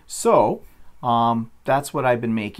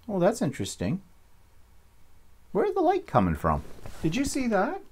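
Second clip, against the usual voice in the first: rising high, startled and curious; surprised; 11 semitones higher, 4 dB quieter than usual. Real, not acted. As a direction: caught off guard by something odd. Notices a strange light streak.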